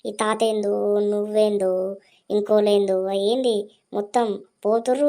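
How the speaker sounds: ordinary talk, nothing marked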